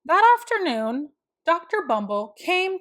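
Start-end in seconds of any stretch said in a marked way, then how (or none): none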